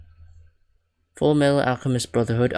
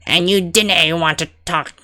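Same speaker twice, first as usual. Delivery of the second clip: higher, slow character voice; drawn-out, higher-pitched governor; put-on governor voice; 6 semitones higher, 3 dB louder than usual. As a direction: play the prison governor, pitched up. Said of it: "fuck it, I'll just use this voice".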